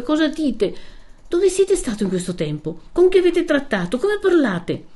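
high, scandalised questions; appalled, urgent questioning; shocked reproach. A narrator's reading. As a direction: rebuke in dismay, pitch jumping up. Lucia shocked at Renzo's words.

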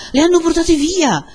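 very high, loud outcry; escalated alarm. A narrator's reading. Villagers shout that the women were taken, escalated alarm. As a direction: spread the alarm at full voice.

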